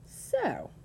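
high, falling, scornful exclamation; disgusted. A narrator's reading. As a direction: draw it out in disdain. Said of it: "he said in disgust".

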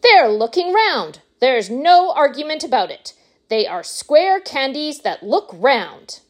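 high-pitched victorious declaration; triumphant. A narrator's reading. Wonka settles the argument with the doubters, high and triumphant. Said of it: "he cried triumphantly".

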